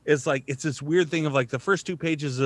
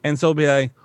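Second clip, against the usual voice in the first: ordinary delivery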